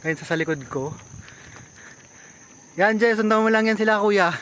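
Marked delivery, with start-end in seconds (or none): 2.8-4.3 calling out. Real, not acted. Calling to rider behind.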